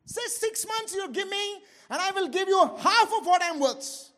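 high, loud begging to be spared; desperate plea. Acted. Dying man begging the doctor for time, desperate. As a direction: bargain frantically for your life. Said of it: "He was so desperate to live".

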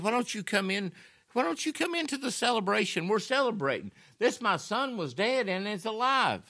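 high, pleading invitation; pleading father. Acted. Father begging his older son to join, high and pleading. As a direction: coax him in with a lifted, eager voice.

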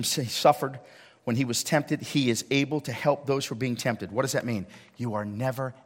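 ordinary talk

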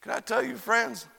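normal talking voice

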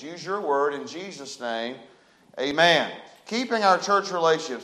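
ordinary talk, nothing marked

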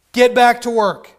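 loud, curt order from Pharaoh; mock angry order. Pharaoh ordering the Israelites back to work, loud and curt. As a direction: bark the order like a tyrant.

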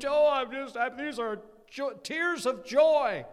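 high, loud comic wailing; hammed-up happy crying, drawn out at the end; mock tearful wife. Imitating a wife with tears of joy, mock wailing. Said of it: "Your wife, she's crying".

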